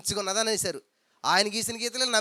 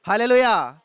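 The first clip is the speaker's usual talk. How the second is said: hallelujah shout; 10 dB louder, slower than usual. Real, not acted. Preacher calls out to congregation.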